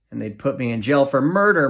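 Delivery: ordinary delivery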